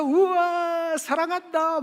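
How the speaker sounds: high, loud cry of love; excited shout of love. Retold or imitated. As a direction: blurt it out with joy. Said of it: "막 그러셨어요". (높고 크게 외치는 사랑한다)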